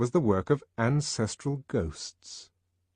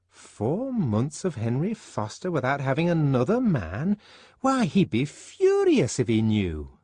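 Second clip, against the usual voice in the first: a fussy friend prattling on; gossipy Fanny. Acted. Fanny lecturing Lenina.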